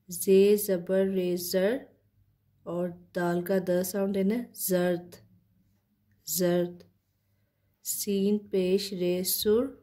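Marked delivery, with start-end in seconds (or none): 0.1-1.8 slow spelling of जे ज़बर रे ज़र; spelling out letters. Shown as a demonstration. Teacher spells जे ज़बर रे ज़र for students, slowly. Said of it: "किस तरह से प्रोनाउंस करते हैं". 7.8-9.8 slow spelling of सीन पेश रे सुर; spelling out letters. Shown as a demonstration. Teacher spells सीन पेश रे सुर for students, slowly. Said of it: "किस तरह से प्रोनाउंस करते हैं".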